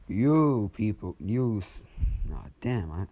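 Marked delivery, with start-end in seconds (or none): 0.1-1.8 loud, drawn-out call to the souls; rally the dead with a slow shout; mock yell. Villain calling out to the dead souls, loud slow yell. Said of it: "Zuko yells out".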